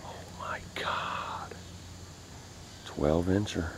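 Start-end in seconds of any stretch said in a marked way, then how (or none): 0.1-1.5 slow awed whisper. Real, not acted. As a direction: breathe it out slowly in awe. Awed at the crappie.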